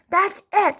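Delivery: dad snaps for good; dad losing his temper in the car; screamed. A narrator's reading. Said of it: "screamed Dad".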